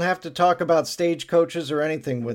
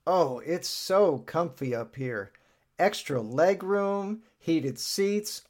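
relaxed bragging; 3 semitones higher, 3 dB quieter, slower than usual. Acted. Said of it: "Lance says".

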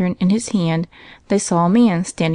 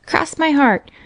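put-on high little boy voice; boy's earnest cry; 8 semitones higher, slower than usual. A narrator's reading. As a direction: vow it eagerly as a child. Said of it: "cried the boy".

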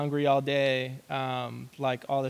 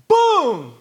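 loud, high imitated impact; mock crash noise; 14 semitones higher, 10 dB louder than usual. Storyteller acting out the truck hitting him, a loud boom. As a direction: shout the crash like a sound effect.

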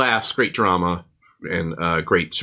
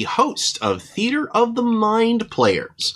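grand announcer voice; 13 semitones higher, slower than usual. Pretend. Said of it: "Only today".

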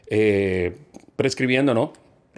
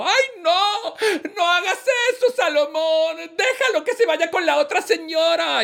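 high falsetto pleading woman; pleading mother. Acted. The real mother begs Solomon not to cut the child, high. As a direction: beg the king in a high voice. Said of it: "Y una dijo".

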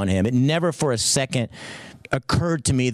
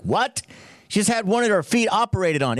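higher, rising surprised what; mock disbelief, pitched up at the end; incredulous reaction; 6 semitones higher than usual. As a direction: imitate a baffled listener. Voices the listeners' expected reaction.

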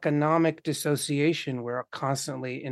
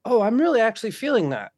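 surprised realization; 6 semitones higher, 4 dB louder, faster than usual. Retold or imitated. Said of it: "To go".

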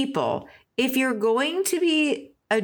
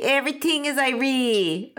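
mock Jamaican, pitched higher; joking higher-voiced Jamaican imitation; Jamaican accent; 5 semitones higher than usual. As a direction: put on a playful Jamaican accent. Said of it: "can't even do a Jamaican accent".

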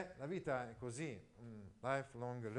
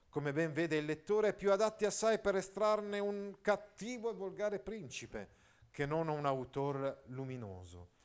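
higher, declamatory literary reading; reading aloud; 4 semitones higher, 4 dB louder than usual. Acted. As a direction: declaim the old prose, slowing at the end. Reads quoted passage.

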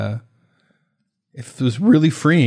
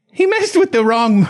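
high, slow British slipping into New Jersey; botched British accent; 11 semitones higher, 4 dB louder than usual. Acted. Garden owner issuing a threat, botched British slipping into New Jersey. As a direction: attempt British, land near New Jersey. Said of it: "a New Jersey accent when I try to do British".

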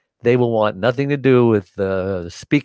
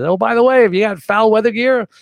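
put-on higher, louder voice of the old sailor; old salt calling out; 12 semitones higher, 5 dB louder than usual. Old sailor calling to the departing captain, higher and louder. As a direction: call after him like a grizzled seaman.

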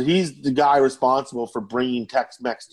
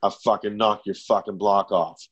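lower menacing threat; 6 semitones lower than usual. Retold or imitated. Manager's threat, retold lower.

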